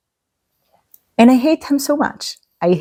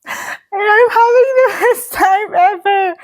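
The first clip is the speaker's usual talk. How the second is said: much higher, laughing through the words; laughing with joy; 9 semitones higher than usual. Real, not acted. Reader gushing to viewers about the book, laughing. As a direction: let the joy spill into a laugh.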